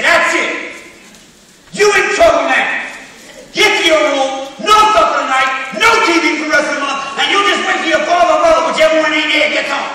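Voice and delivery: loud stern parent laying down the law; hammed-up angry parent scolding. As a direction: bark out punishments at the kid. Parent scolding kid in bit.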